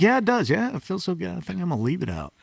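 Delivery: quoting the high school buddy's catchphrase, lightly impersonated; imitating a buddy. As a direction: lounge into it like a stoner pal. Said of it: "That's Greg Slagle".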